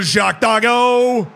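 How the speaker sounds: high, drawn-out excited cry; excited game call. Real, not acted. Streamer reacting to a player on the ball, excited call.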